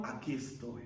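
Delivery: low, hushed reply to God; quiet acceptance; same steady answer. Acted. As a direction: answer softly and low, in submission. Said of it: "contesta en las dos situaciones de la misma manera".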